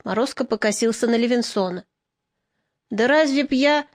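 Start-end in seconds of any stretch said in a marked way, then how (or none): none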